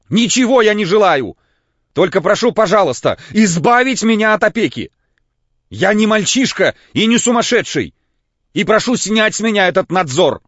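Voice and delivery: очень высоко и громко, срываясь; кричит, шатаясь от волнения; истеричный крик. A narrator's reading. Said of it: "крикнул Лаевский". (истерический вопль, высоко и громко)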